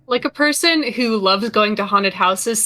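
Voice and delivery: ordinary talk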